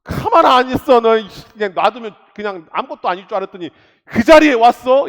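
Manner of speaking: Satan raging at the faithful, shouting; angry Satan. Acted. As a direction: bellow as an outraged Satan. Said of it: "사단이 여러분들 노려서".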